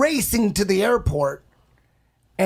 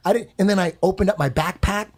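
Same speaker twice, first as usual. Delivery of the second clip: usual speaking voice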